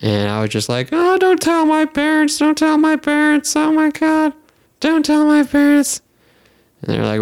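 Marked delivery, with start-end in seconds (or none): 0.9-6.0 very high, loud mock wail; hammed-up high-pitched begging; panicked pleading. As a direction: beg in a squealing drunken panic. Retold drunken pleading at hospital.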